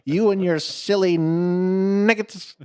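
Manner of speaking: high, loud, slow mock French accent; French taunter. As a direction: sneer it in a silly French accent. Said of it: "the Frenchman".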